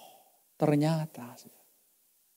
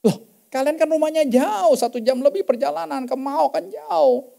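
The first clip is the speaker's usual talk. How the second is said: much higher, incredulous; surprised objection; 9 semitones higher, 3 dB louder than usual. Retold or imitated. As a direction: protest in disbelief. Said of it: "kata delapan belas kan".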